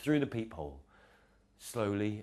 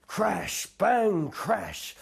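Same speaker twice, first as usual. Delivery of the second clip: thunder noises; 12 semitones higher, 6 dB louder, much slower than usual. Acted. Thunder sound effects.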